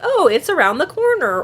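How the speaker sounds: helpful shop worker. Retold or imitated. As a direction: sing out helpful directions lightly. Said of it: "I'm like".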